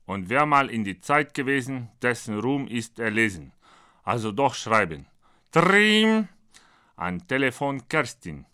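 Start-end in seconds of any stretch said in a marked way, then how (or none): 5.5-6.3 high, loud trilled ring; imitated phone ring. Narrator imitates the phone before calling Kerstin, loud ringing.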